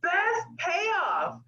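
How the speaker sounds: reliving the moment, voice breaking into a cry; crying quote. Retold or imitated. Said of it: "I was like".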